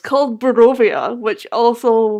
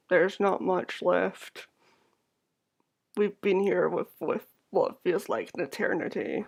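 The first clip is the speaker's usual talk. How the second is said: soft, low, lifeless townsperson; eerie hollow voice; 3 semitones lower, 6 dB quieter than usual. Acted. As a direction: drone it softly, drained of life. Eerie tavern keeper speaking.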